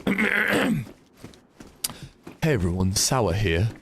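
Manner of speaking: gruff attempt at another streamer's intro; Sour. Shown as a demonstration. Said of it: "trying to do sour".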